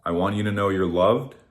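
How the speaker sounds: ordinary delivery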